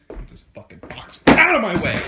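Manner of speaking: loud, exploding into a yell; hammed-up burst of rage; angry outburst. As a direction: snap into a shouted tantrum. Acted tantrum at a box.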